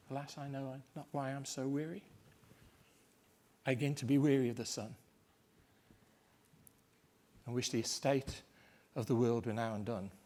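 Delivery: soft, drained tiredness; weary sigh. Shown as a demonstration. Lecturer showing Macbeth's weariness, quiet and tired. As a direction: let it sag with weariness. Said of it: "a weariness".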